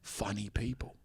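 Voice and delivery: soft, breathy invite; hushed Statham voice. Retold or imitated. Jason Statham asking a comedian to hang out. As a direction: murmur it, shy and eager.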